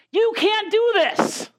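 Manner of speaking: high, slow, sneering taunt; devil's taunting voice. Retold or imitated. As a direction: drag it out like the devil mocking. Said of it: "The enemy says".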